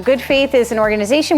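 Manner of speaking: ordinary talk, nothing marked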